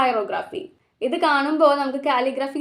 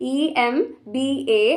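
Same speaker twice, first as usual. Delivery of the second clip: ordinary delivery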